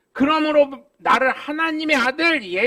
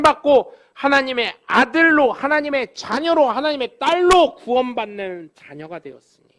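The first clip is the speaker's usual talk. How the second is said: louder, rising fervent proclamation; preaching at full force; 2 semitones higher, 5 dB louder than usual. Acted. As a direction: proclaim salvation to the congregation. Preaching at climax. (회중에게 구원을 힘차게 외치는 설교)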